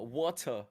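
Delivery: "water" with a careful English T; T pronounced. Retold or imitated. American practising for his English friend, careful-T "water". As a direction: copy English "water", sounding the T. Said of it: "Just gotta pronounce the T's".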